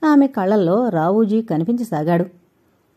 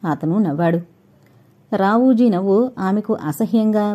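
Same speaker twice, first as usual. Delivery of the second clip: ordinary delivery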